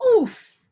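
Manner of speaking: sudden loud oof of a stumble; high, loud pained grunt. Acted. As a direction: blurt a startled oof as you fall. Kelp stumbling on land.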